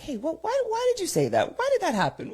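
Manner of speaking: very high-pitched self-mocking voice; annoying questioner. Speaker mimics herself questioning a friend, high-pitched mock pestering. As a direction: pester with high, insistent questions, laughing at the end. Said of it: "it's annoying being around me sometimes because I'm like".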